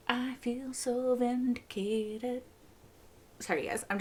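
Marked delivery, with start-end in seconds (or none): 0.1-2.4 soft, high, slow singsong; blissful gloating. Real, not acted. Viewer basking in being proven right, blissful gloating. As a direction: stretch it out softly, basking in it.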